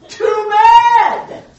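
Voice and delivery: very high, loud retort; emphatic shout. Real, not acted. Preacher shouting back at the self-esteem objection. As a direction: shout it down, no sympathy. Answering his own objection.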